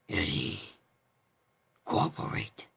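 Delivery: very low, whispered monster rasp; creepy voice. Shown as a demonstration. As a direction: command like a lurking creature. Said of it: "with the creepy voice, like this".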